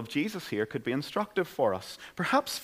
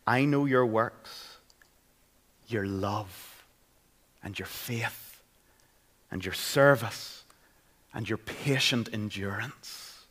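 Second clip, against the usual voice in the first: gentle reassurance; 3 semitones lower, slower than usual. Acted. Jesus gently encouraging the church at Thyatira, slow and tender. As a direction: speak it like a comforting promise. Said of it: "how Jesus continues to speak to the church".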